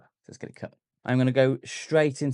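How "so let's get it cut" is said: whispering under his breath; whispered aside. Real, not acted. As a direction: whisper it to yourself while working. Murmured aside while cutting.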